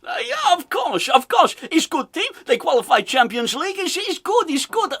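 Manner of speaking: high-pitched, hammed-up Dutch accent; mock Dutch accent. Host mocking a football manager's interview, exaggerated Dutch accent. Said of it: "He's speaking in a Dutch accent".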